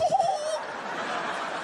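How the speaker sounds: very high cartoon mouse giggle; squeaky Mickey laugh. Mickey Mouse laughing at the comic, squeaky giggle.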